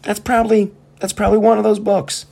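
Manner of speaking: high, loud, breaking into laughter; cracking up, voice way up and loud; laughing squeal. Real, not acted. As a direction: squeak it out through the giggles. Cracking up at own joke.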